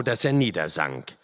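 ordinary delivery

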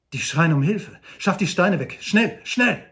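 urgent shout. Acted. Old Shatterhand orders the men to clear the stones, urgent shout. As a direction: command them to dig, fast.

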